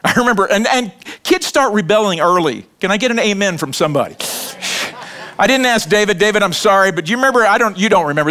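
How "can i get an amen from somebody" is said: normal talking voice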